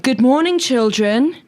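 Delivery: high sing-song assembly greeting; lightly voiced head teacher. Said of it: "she'd go like".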